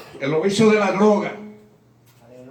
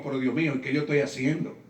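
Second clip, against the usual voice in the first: worried inner voice; 6 semitones lower, 6 dB quieter than usual. Retold or imitated. Inner worried thought in subway.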